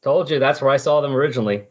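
ordinary talk, nothing marked

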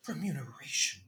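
whispered. Acted. Repeats the word to himself.

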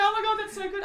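high, giddy self-quote; laughing, high-pitched excited reaction; retold delight. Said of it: "I was like".

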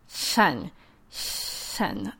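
"shen" with the middle of the tongue on the roof of the mouth; palatal lisp demo. Shown as a demonstration. Therapist showing parents a palatal lisp, "shen" for sun. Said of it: "instead of sun, it would be".

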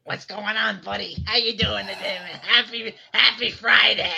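loud raspy smoker voice; chain smoker voice. Acted. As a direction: rasp it out like a heavy smoker. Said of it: "sound like a chain smoker".

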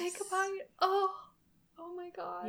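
high, breathless swoon over food. Acted. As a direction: swoon like it's the best bite ever.

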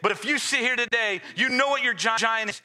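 high, driving preacher call; rally the church with rising fervor; booming motivational speech. Acted.

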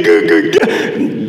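mock goo-goo baby noises; baby babble. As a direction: babble mockingly like an infant. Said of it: "treated like a baby".

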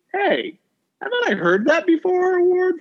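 squeaky high put-on quote voice, playful; high mock award voice. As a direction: pitch it way up like a silly award title. Repeats award name in put-on voice.

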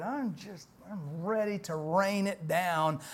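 ordinary talk, nothing marked

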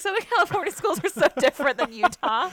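loud amazed exclamation; excited disbelief. Retold or imitated. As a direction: blurt it out, amazed. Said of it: "I was like".

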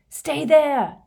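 urgent high, loud shout. A narrator's reading. Harry to the others over howling wind, shouting. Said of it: "he shouted at the others over the howling wind".

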